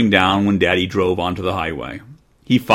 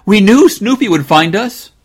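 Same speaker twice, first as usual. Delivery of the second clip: put-on high, louder girl's call; 11 semitones higher, 7 dB louder than usual. Jane calling down about Snoopy finding them, high loud call. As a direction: call it out proudly to the parents. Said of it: "called Jane".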